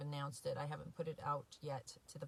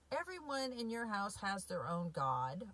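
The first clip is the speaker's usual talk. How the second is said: ordinary talk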